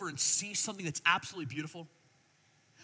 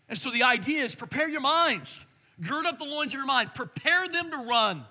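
preaching; 5 semitones higher, 7 dB louder than usual. Acted. Preaching at full force.

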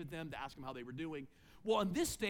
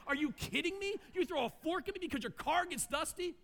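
much higher, indignant disbelief; incredulous outrage; 9 semitones higher than usual. Retold or imitated. Pastor imagines snapping at the son who threw a fork, incredulous outrage. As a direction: let the outrage burst out in disbelief. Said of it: "That's what I wanted to say".

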